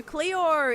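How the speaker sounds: CLEOR with the final O drawn into "aur", high and loud; Australian O ending. Shown as a demonstration. Streamer agreeing with viewers about O's, CLEOR ending "aur". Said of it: "A U R on the end".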